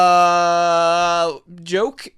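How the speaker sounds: high, loud, held uh, then tentative joke; mock-awkward offered excuse; sheepish excuse. Kid answering the angry teacher, sheepish excuse.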